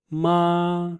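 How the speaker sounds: MA held high and level; sing-song MA on one steady high note; flat first tone. Shown as a demonstration. Teacher showing ma has the beep's tone, high and flat. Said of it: "exactly the same tone".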